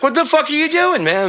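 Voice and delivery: high yelled scold at a misbehaving dog; yelling at the dog. Retold or imitated. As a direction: bark it at the dog, exasperated. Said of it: "with a dog, you can be like".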